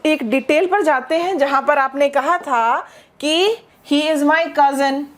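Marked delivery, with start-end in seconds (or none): none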